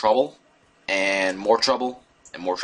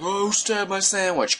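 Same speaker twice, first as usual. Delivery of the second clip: mock angry bully; 10 semitones higher than usual. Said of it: "and he's like".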